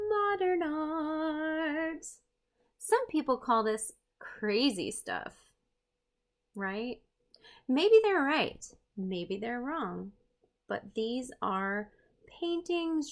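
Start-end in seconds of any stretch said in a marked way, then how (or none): 0.1-2.1 slow, high, drawn-out; dramatic reveal. Acted. Introducing modern art to viewers, slow and drawn out. 7.7-10.1 playful teasing. Acted. Playful weighing.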